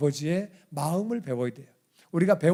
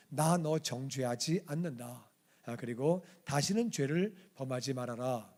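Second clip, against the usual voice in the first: gentle forgiveness; 4 semitones lower, 7 dB quieter than usual. Retold or imitated. Jesus forgiving the woman.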